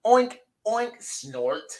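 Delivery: oink like a silly pig; playful pig noises for kids. Acted.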